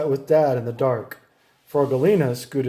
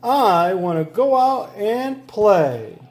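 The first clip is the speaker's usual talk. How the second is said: whining; 6 semitones higher, 3 dB louder, slower than usual. A narrator's reading. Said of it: "cried Froggy".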